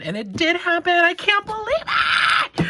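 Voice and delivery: sudden high, loud squeal of disbelief; excited shriek. Real, not acted. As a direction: burst out, then catch yourself. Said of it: "Okay, sorry".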